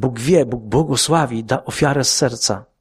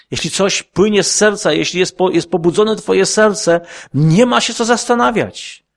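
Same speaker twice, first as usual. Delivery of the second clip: raised, higher, insistent preaching voice; fervent preaching; 6 semitones higher, 4 dB louder than usual. Acted. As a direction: press every phrase home to the congregation. Preaching rising to full force. (podniesiony, żarliwy ton kaznodziei)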